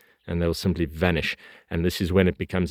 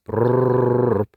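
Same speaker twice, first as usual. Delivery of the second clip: rolling brrr call to the animals; lip trill call; 5 semitones higher, 6 dB louder than usual. Real, not acted. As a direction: trill the lips to call the mongoose. Calling the mongoose with trill.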